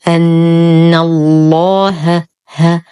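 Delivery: slow أن الله, heavy ل then a thin clean ه; light haa. Shown as a demonstration. Teacher shows freeing the haa from the heavy laam, thin haa. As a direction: keep the ه of الله light after the thick ل. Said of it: "نرقق الهاء ونخلصها من تغليظ اللام".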